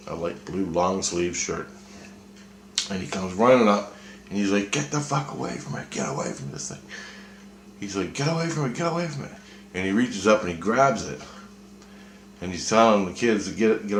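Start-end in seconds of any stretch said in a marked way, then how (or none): 4.7-6.8 retold urgent warning. Man ordering kids away from it, retold urgent warning. 8.1-9.3 high-pitched, softly imitated yell; retold urgent warning. Man telling kids to get away, high mock warning.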